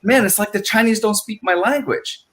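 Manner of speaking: a confused guy complaining; Vinny grumbling about language; older Vinny's line. Retold or imitated.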